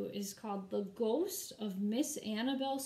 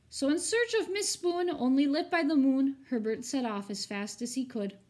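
rhythmic, storybook read-aloud; story narration; 5 dB louder than usual. A narrator's reading. Teacher reading a rhyming picture book to her students, expressive storybook narration. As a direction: read the rhymes to the class with drama.

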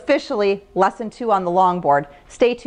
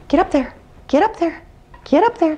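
calling a dog; 6 semitones higher than usual. Real, not acted. Calling dog onto board.

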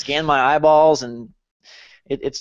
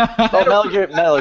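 laughing; 4 semitones higher, 4 dB louder than usual. Real, not acted. Laughing while recalling the game.

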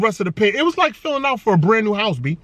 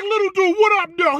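mock hype greeting; 8 semitones higher than usual. Greeting the kid in retold scene.

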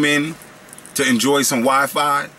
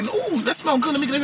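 mock girl voice; 11 semitones higher than usual. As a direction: imitate a girl sweet-talking for a taste. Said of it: "Chick come to me like".

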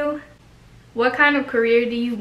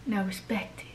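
whisper; 2 semitones lower, 11 dB quieter than usual. Acted. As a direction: hiss it softly, smug. Whispered boast.

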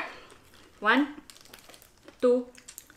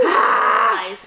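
shout; 2 semitones lower, 6 dB louder than usual. Real, not acted. Shouted cheer.

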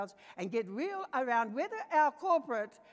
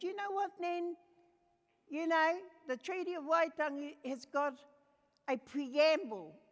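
put-on child's voice; 6 semitones higher, 3 dB louder than usual. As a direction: build up to preamble like a proud child. Grandchild introduces the preamble.

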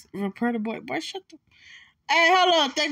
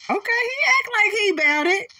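mock tough voice; 10 semitones higher, 4 dB louder than usual. Mocking a game character's swagger.

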